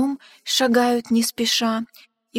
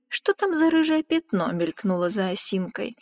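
ordinary delivery

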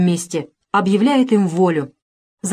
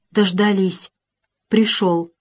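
usual speaking voice

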